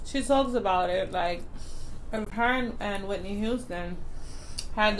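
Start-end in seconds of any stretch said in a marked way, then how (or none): 0.1-3.4 chewing between words; talking while eating; mouth full of sandwich. Real, not acted. Seen 0: bites sandwich at 1.3s, chews with full cheeks while speaking.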